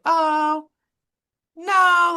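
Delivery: high, drawn-out hesitant no; awkward polite refusal. Retold or imitated. Waxer turns down client's invite, high awkward politeness. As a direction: pitch it way up to soften the refusal.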